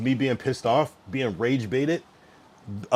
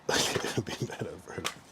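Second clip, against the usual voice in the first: real laugh breaking into soft words; 5 semitones lower, 11 dB quieter than usual. Real, not acted. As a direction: say it quietly through laughter. Amused by a viewer's comment.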